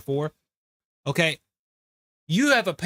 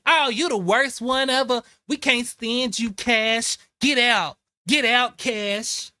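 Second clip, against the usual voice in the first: mocking the other side; 6 semitones higher than usual. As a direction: jeer him out of the room in a sing-song voice. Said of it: "But your side is like".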